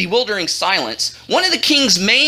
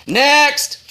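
bored; 4 semitones higher than usual. A narrator's reading. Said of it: "The king was so bored".

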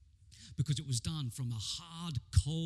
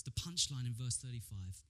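ordinary delivery

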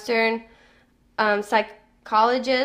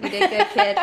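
bright teasing squeak, pitched up high; playful high praise; 10 semitones higher than usual. Acted. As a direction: praise them like a proud parent, high and light. Playful praise after sneeze stops.

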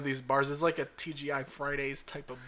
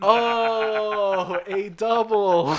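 laughing realization; 7 semitones higher, 9 dB louder, much slower than usual. Retold or imitated. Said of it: "mock self getting it".

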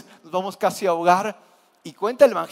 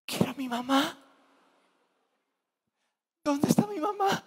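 mock whimpering; 8 semitones higher, 5 dB quieter than usual. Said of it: "Se pusieron a llorar como niñitas".

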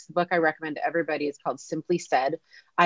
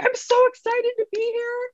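squeaky, much higher excited gush; hammed-up eager greeting; mock excited; 3 dB louder than usual. Greeting the boss Ben, a hammed-up high excited gush.

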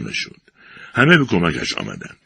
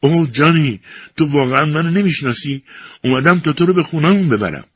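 high, loud desperate cry; much higher, loud pleading shout; 11 semitones higher, 3 dB louder than usual. A narrator's reading. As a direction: cry out to make him remember. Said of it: "فرانکی فریاد زد".

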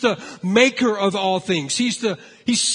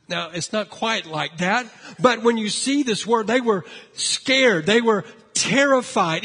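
ordinary delivery

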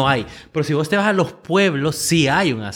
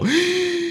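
sharp, high mock gasp; acted gasp of realization. Someone finally told their partner's flaws, acted gasp. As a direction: gasp as if suddenly noticing. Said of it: "en ese momento quedas como".

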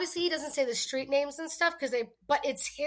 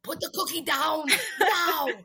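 movie quote; 4 semitones higher, 4 dB louder, slower than usual. Retold or imitated. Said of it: "movie quote".

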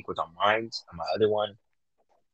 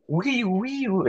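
very high-pitched "wee" noise; playful imitation siren; high siren wail; 12 semitones higher, much slower than usual. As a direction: squeal out a siren sound.

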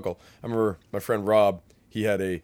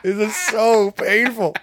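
pained listener; 12 semitones higher, 10 dB louder, slower than usual. Retold or imitated. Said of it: "they're like".

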